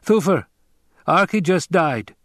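high, urgent call. A narrator's reading. Aide bringing Hawat news of a death, calling. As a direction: call the name sharply, then report. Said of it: "Hawat's aide called".

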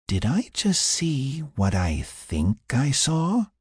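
slow, high, disbelieving question; incredulous question. A narrator's reading. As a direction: ask it slowly, word by word, rising at the end. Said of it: "Adia asked".

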